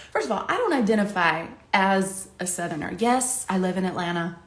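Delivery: ordinary talk, nothing marked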